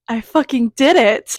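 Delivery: loud, slow triumphant yell; real birthday triumph; triumphant shout. Real, not acted. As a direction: shout it like you won. Turning twenty-one at midnight.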